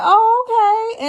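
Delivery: high, drawn-out oh okay, pleasantly surprised; mock-impressed reaction to a stunning entrance.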